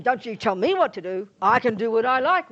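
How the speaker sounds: loud, indignant pushback; defiant retort. Retold or imitated. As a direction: snap back at being told off. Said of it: "we'll say".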